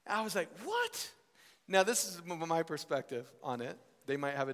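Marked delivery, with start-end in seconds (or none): 0.6-1.1 high-pitched incredulous "what?"; mock disbelief. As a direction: squeak it out in surprise. Acting out his stunned reaction.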